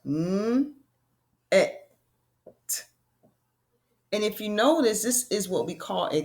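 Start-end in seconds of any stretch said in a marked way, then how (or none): 0.1-1.8 "Mm, et" as separate letter sounds; sounding out. Shown as a demonstration. Teacher shows children the second syllable, "Mm, et" sounded out. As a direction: hold the "Mm", pause, then "et". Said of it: "Then we have net".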